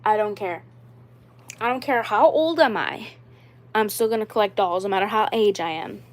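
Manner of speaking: ordinary delivery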